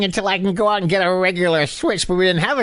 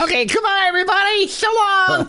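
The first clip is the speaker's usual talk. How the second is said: cheery goodbye; 12 semitones higher than usual. Acted. Arnie waves goodbye, leaving.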